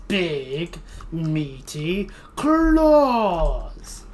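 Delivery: very slow, drawn-out cartoon quote; SpongeBob quote voice. Shown as a demonstration. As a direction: stretch it out like the cartoon. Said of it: "That's the correct way of saying it".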